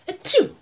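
loud, high, hammed-up achoo; theatrical sneeze for the kids; big comic sneeze. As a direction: blast out a cartoon sneeze. Character sneezes in story.

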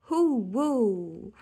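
loud hooting game call; playful shout. Acted. Posy whooping in a noisy game, playful shout. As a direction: whoop it out in play. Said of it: "shouted Posy".

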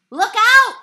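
high, loud alarmed yell; yelled warning. A narrator's reading. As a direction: shout the warning as the ball flies. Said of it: "yelled Mahoney".

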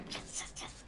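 quick voiceless little cheer; excited whisper. Real, not acted. Player pleased at finding ammo, excited whisper. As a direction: whisper your celebration. Celebrating found ammo quietly.

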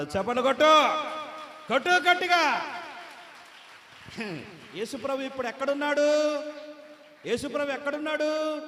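loud, high rallying preacher; preaching at full force. Acted. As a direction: whip the crowd into clapping. Preacher rallies crowd to clap.

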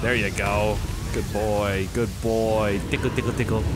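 zacht lager koosstemmetje tegen een huisdier. Real, not acted. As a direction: lief en sussend, als tegen een hondje. Talking sweetly to pet dragon.

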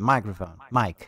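microphone said as English majkrofon; English word microphone; English pronunciation. Shown as a demonstration. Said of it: "microfone em inglês".

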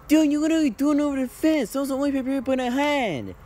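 shouting at a friend who threw the only paper airplane over the fence; angry yell. Acted. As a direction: yell at him for losing the plane. Angry that the plane went over the fence.

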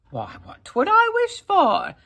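very high, loud incredulous ask; indignant question. A narrator's reading. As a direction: scoff at the idea. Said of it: "Jamie asked".

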